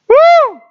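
very high, loud "woo"; whooped exclamation. Real, not acted. Preacher asking where the church's passion is, loud whoop.

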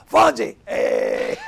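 loud mock catchphrase holler; retold fan call. Stranger in a car hailing Henry Winkler in Italy, hollering. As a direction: call out to a TV idol, imitating an excited fan. Driver calls out to the Fonz.

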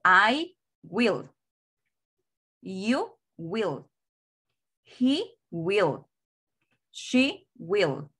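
pronoun and "will" as two separate words, pause between; long form. Shown as a demonstration. Teacher models long forms for students, two separate words. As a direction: say each full form, "will" apart and falling. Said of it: "en forma larga yo digo".

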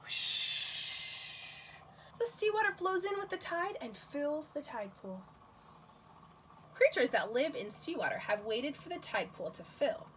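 put-on gentle storybook reading for kids; reading to children. Librarian reading a tide pool book to children, gentle storytime voice. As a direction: read the book aloud to little ones.

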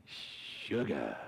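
very low, soft swaggering purr. Shown as a demonstration. Pastor wishing couples such love, with swagger. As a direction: drop deep and draw it out with swag. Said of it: "some kind of swag to it".

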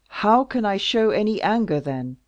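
loud, commanding royal fury; angry king. Acted. King vowing vengeance for Louise, loud and furious. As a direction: thunder out threats of revenge.

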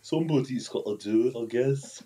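badly faked Liverpudlian; mock Liverpool accent.

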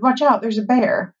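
high, urgent warning cry; shouted warning. A narrator's reading. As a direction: alert your brother to danger. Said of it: "he shouted".

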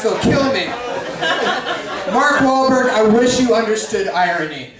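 ordinary talk, nothing marked

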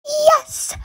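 high, bursting little yes; excited cheer. Acted. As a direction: cheer like you just won. Child cheering at news.

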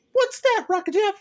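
hammed-up high imitation of a friend; imitating Margie. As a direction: squeak it out like a friend teasing. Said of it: "said Margie T V would say".

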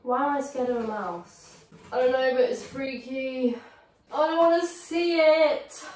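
ordinary talk

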